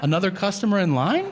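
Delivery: high, laughing incredulous question; can't believe it, laugh through it; amused mock disbelief. Mocking incredulity.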